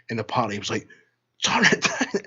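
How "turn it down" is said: high, exasperated-crowd imitation; mock scream. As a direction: imitate people yelling into a mic. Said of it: "people were screaming at the mic".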